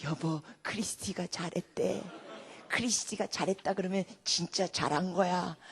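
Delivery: low, slow imitation of dad; father addressing mother. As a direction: play a proud dad reporting to his wife. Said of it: "옆에다 대고 또 엄마한테 그래요". (엄마에게 말하는 아버지를 낮고 느리게 흉내)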